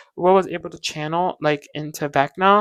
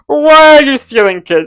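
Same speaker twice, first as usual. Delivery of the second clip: loud, higher, in a changed voice; outraged shriek; 7 semitones higher, 9 dB louder than usual. Retold or imitated. Vlogger scolds Vecna again, loud mock shriek.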